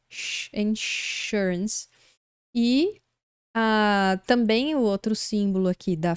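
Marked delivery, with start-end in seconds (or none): 0.5-1.9 insurance drawn out slowly with a clear sh; sh sound demo. Shown as a demonstration. Teacher shows the sh sound in insurance, slowly. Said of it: "por isso que a gente fala".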